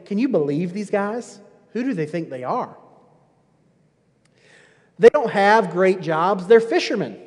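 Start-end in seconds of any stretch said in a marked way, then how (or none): 0.0-2.8 snide remark. Retold or imitated. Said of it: "making a snide remark saying".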